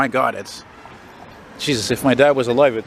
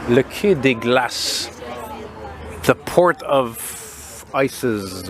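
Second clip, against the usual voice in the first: slow savoured reading; much slower than usual. Real, not acted. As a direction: draw out the name slowly. Savours shop name and translation.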